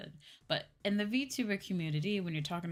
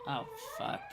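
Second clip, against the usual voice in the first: startled curse; 3 dB quieter than usual. Real, not acted. Startled by a passing siren.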